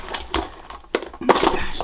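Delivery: whisper. Real, not acted. Struggling with tight packaging.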